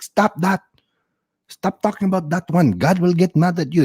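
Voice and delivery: high-pitched imitation of a scolding father; upset religious dad. Religious dad warns his joking child God will get mad, mock upset. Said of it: "he gets really upset".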